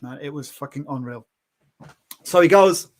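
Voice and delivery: plain reading voice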